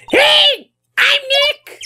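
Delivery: high shouted call at the device; loud for the mic. Shown as a demonstration. Shouting to the voice-recognition camera, high and loud. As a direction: call it out high so the machine hears. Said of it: "Maybe I'm not saying it loud enough".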